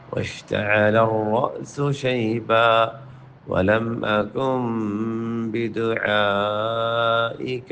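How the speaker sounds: slow, sung tajweed recitation; Quran recitation. Acted. Teacher reciting the verse for students in a melodic voice. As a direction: chant the verse, drawing each word out.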